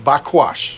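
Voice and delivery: Bakwash said as bah-KWAHSH, falling at the end; pronouncing Hebrew. Shown as a demonstration. Preacher finishes spelling the Hebrew word, careful pronunciation. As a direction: say Bakwash with a w glide and falling close. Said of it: "If that's how you pronounce it".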